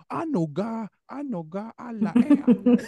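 sing-song Batangueño taunt, mimicking classmates; mocking Batangueño accent. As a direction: playground mockery of a Batangas accent. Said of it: "yung accent ko, Batanggenyo".

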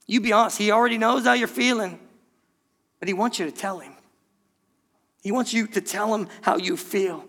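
ordinary delivery

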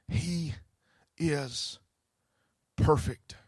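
whisper-soft declaration of awe; hushed awe. Real, not acted. Hushed awe after the chant.

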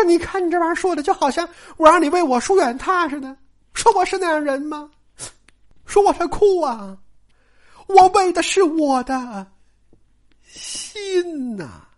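protest, then let it sink to a confession; hurt Daiyu. Acted. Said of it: "但是说".

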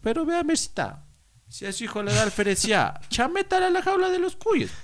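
very high-pitched caricature of a meddling auntie; typical advice-giving neighbour. Retold or imitated. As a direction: pipe up like the neighbour with a remedy. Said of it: "la típica vecina que da el consejo". (vecina consejera, imitada con voz muy aguda)

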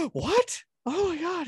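sharp gasp, then very high disbelief; shocked kid. Retold or imitated. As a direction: gasp and squeal in astonishment. Stepson's shocked reaction, acted.

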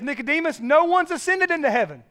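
Jesus's words, preached high and loud; Jesus to Nicodemus, preached out loud and high; forceful preaching. Acted. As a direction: proclaim it at full force, high and ringing. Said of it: "And Jesus says".

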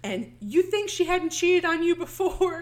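a retold jealous fight, voiced loud; angry retort. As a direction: snap back at him in a mock rage. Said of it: "She's like".

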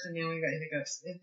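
normal talking voice

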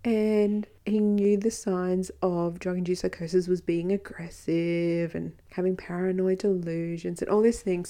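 normal talking voice

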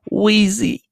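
high drawn-out rapper ad-lib. Acted. Podcaster playing at rapper with a lighter, high ad-lib. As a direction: squeal it like a rapper's signature tag. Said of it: "like I'm [name] right now".

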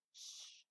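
whispered shush; a soft unvoiced shh to quiet Marcus; hushing shh. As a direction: hush him sharply under the breath. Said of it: "said Erica".

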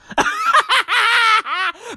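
high, wheezy run of cackles. Real, not acted. Host amused by the Trump gossip, high cackling laugh. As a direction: crack up in a long, high-pitched laugh.

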